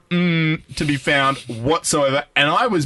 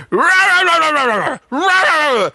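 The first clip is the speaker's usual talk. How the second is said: screaming Danish guy; 11 semitones higher than usual. Retold or imitated. Imitating the angry Danish man screaming at them. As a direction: bellow nonsense like a raging stranger. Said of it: "he goes".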